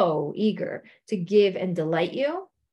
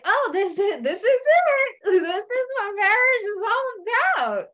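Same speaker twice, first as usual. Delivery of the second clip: high, bright fake-smile voice with a laugh; fake cheerful; 10 semitones higher than usual. Stuck couples greeting a newcomer, forced cheerful laugh. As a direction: sound resigned behind a forced grin. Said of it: "they give you this big fake smile".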